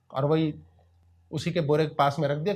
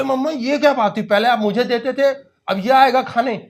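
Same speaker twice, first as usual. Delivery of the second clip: put-on higher, louder little boy protesting; whiny child; 7 semitones higher, 6 dB louder than usual. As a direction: sulk like a jealous kid. Child complains to mother.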